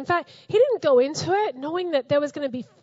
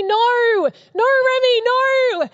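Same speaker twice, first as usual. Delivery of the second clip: scolding the puppy; 8 semitones higher, 6 dB louder, slower than usual. Retold or imitated.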